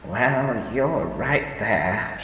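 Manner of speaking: put-on slow, rueful old woman admission. A narrator's reading. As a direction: admit it ruefully. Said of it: "she admitted ruefully".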